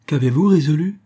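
high, loud alarmed question; sudden terror. A narrator's reading. Daughter questioning father's decision, suddenly terrified. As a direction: cry out in sudden fear. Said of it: "l'examinant avec une terreur soudaine".

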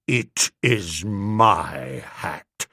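low, very slow, icy menace; cold, deliberate, nasty correction; coldest, nastiest voice. A narrator's reading. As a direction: draw each word out low and cold. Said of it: "in about the coldest, nastiest voice".

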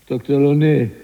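loud, high summoning shout; high-pitched, insistent call; urgent shout. Acted. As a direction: shout out for the doctor. Prisoner calls doctor more urgently.

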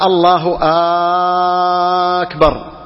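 أكبر begun with a very long "aaa"; long aaa-kbar after الله; stretched hamza of أكبر. Shown as a demonstration. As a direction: normal الله, then hold the first vowel of أكبر. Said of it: "يعني مد همزة أكبر".

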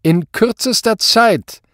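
high, loud, slow; commanding demand. A narrator's reading. Superior sets the commissioner a deadline, commanding. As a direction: spell out the deadline forcefully.

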